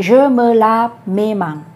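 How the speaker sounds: very slow, "mes" laid out clearly; teacher models the wrong sentence very slowly; slow wrong example. Shown as a demonstration. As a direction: model the mistake slowly so it is heard. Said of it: "ขวัญจะไม่พูดว่า".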